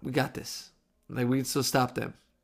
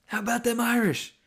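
imitated bartender's teasing call, much higher; bartender taunt; 10 semitones higher than usual. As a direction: rib him from across the room. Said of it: "He says".

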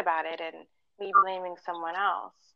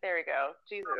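ordinary talk, nothing marked

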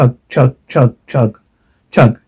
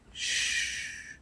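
hushing whisper; 25 dB quieter than usual. Acted. Attacker hushes the victim.

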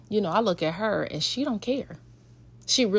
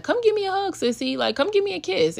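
high cooing call; 11 semitones higher than usual. Retold or imitated. Mom coaxing daughter for hug.

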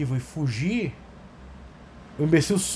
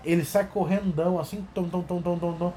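usual speaking voice

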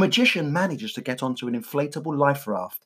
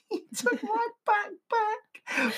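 put-on laughing child voice; 7 dB quieter, much slower than usual.

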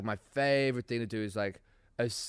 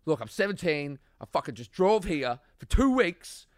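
raised, emphatic pleading; retold impassioned plea; 5 semitones higher, 6 dB louder than usual. Teenager begging a festival worker for help, retold impassioned plea. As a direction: plead your case, louder on the big hardships.

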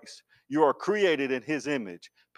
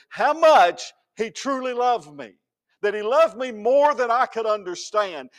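rising louder and higher to a climax; building preaching; 8 semitones higher, 5 dB louder than usual. Acted. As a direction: build the fervor line by line.

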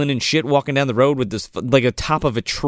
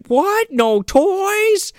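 very high-pitched mock kid dismay; imitated child's incredulous question; shocked little boy; much slower than usual. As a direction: squeak it like a stunned small child. Voices little Jimmy shocked.

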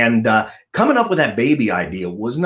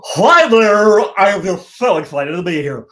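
higher, louder, gushing camp voice; 8 semitones higher, 6 dB louder than usual. Acted. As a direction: gush it out like a camp showman.

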